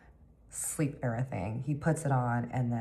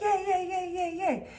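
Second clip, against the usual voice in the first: excited squeal. Retold or imitated. Two friends bouncing over the Vegas plan, squealing.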